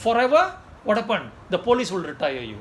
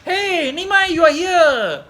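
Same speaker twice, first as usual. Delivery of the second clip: much higher and louder surprised call; a mother spotting her child, loud and high; 11 semitones higher, 11 dB louder, much slower than usual. Retold or imitated. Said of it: "The mother say".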